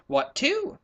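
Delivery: eager high question; excited. Acted. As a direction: leopard bursting with excitement. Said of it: "tremendously excited".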